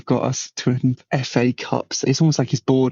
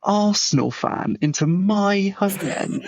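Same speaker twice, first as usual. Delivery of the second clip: incredulous, higher-pitched rebuff; scornful refusal; 6 semitones higher than usual. Retold or imitated. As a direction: act out an outraged refusal. Acting scornful refusal to relative.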